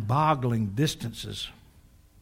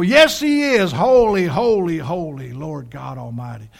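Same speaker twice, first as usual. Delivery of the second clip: answering chorus of praise; 7 dB louder than usual. Retold or imitated. Preacher voices the other heavenly beings answering, crying out then fading. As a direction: start at full cry and come steadily down. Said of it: "And the others go".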